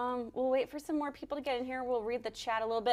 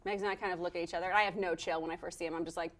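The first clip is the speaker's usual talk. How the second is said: usual speaking voice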